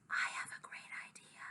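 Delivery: hushed, secretive whisper; very soft conspiratorial whisper. A narrator's reading. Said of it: "Violet is whispering to Luke".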